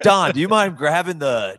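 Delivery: acting out a wife's loud request; wife calling husband. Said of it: "they're like".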